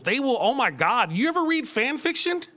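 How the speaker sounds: ordinary delivery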